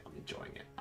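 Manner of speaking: whispered repeat to himself; whispered echo. Real, not acted. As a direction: breathe it out as a whisper.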